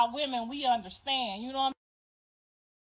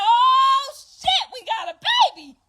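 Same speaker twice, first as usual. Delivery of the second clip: much higher, louder joyful yell; excited shout; 11 semitones higher, 10 dB louder than usual. Retold or imitated. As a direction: cheer it out as the baby arrives. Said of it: "I said".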